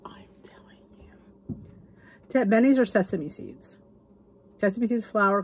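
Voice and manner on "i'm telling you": barely audible whispered aside; awed whisper. Real, not acted. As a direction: whisper it, overcome by the food.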